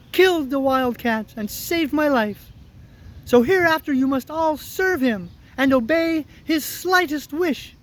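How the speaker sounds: high-pitched tiny ruler; squeaky mouse queen. Acted. Mouse queen ordering her subjects to serve, high squeak. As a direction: command it in a little mouse voice. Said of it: "she answered".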